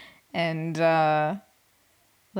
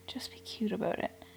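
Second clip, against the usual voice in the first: whispered plea; 11 dB quieter than usual. Real, not acted. Pleading aside.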